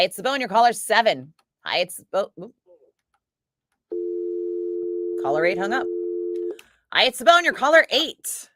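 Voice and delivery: radio phone greeting. Acted. DJ answering contest callers with upbeat greeting. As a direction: chirp the station name to each caller.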